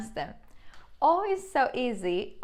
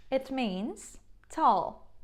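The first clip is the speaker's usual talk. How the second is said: ordinary delivery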